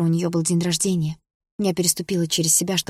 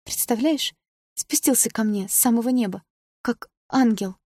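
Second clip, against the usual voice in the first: put-on drunk dreamy voice; 5 semitones higher than usual. Drunk sister raving.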